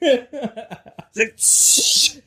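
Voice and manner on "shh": loud, voiceless rushing hiss; imitated whoosh. Imitating mom's explosion, hissed whoosh. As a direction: blow out a burst of noise. Imitating a noise before boom.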